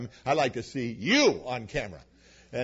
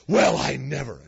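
a much louder, indignant huff; offended huff; 9 dB louder than usual. Retold or imitated. Offended churchgoer huffs at the preacher, loud indignation. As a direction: gasp it out as if scandalized.

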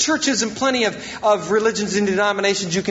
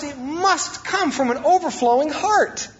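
"must" pitched up and punched; stress on must; 5 semitones higher than usual. Real, not acted. Preacher on serving from the heart, "must" stressed. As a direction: lean into "must", keeping the line raised. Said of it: "I emphasize the word must".

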